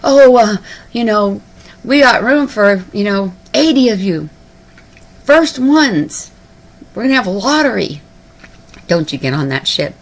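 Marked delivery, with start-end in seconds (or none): 0.0-8.0 higher, louder mock salesman; ticket seller's pitch. Ship sellers offering places by lottery, mock sales pitch. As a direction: pitch it high, like a casual huckster. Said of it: "and they're saying".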